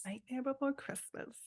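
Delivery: whispered title; whispering. Real, not acted. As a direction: breathe it, no voice.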